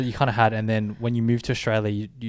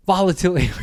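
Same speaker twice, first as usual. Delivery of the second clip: mocking; 6 semitones higher, 4 dB louder than usual. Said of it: "He was like, mocking".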